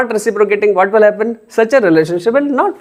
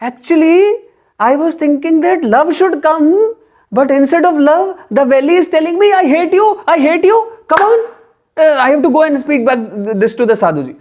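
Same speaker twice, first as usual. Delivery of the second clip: higher, agitated, disbelieving voice; surprised and indignant; 8 semitones higher than usual. Acted. Man stunned that the valley answered with hate, agitated and high. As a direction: sound baffled and upset by what came back.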